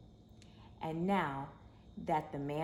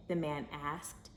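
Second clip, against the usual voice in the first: ordinary delivery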